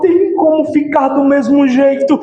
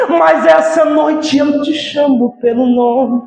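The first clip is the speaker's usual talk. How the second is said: preaching at full force; 3 dB louder than usual. Acted. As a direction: call it out over the congregation, building. Preaching climax as Jesus. (pregação inflamada, voz forte)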